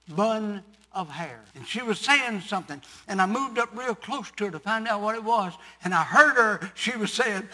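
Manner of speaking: ordinary talk, nothing marked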